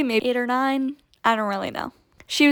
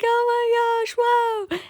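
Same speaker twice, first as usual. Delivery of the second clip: much higher, hushed, playful wonder; mock awe; 13 semitones higher than usual. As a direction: echo her amazement in a light put-on voice. Narrator mock-echoes girl's awe.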